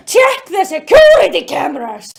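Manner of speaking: loud, theatrical character voice; hammed-up urgent order. Olivia to the officer, hammed-up loud demand. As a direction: belt out the instruction like a cartoon heroine.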